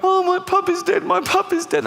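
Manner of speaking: very high, mock tearful wail; wailing child. Child finds puppy dead on Christmas, high mock wail. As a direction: wail it like a distraught little kid. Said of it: "they go".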